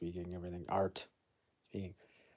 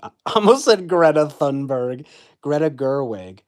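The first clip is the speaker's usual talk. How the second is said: laughing; 4 semitones higher, 12 dB louder than usual. Real, not acted. Laughing at own slip.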